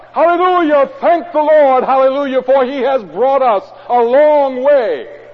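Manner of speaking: high, loud exultant shout of praise; ringing, triumphant hallelujahs; jubilant hallelujah. Shown as a demonstration. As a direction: proclaim it like you just hit the lottery. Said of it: "I have to say".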